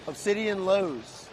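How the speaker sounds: ordinary talk, nothing marked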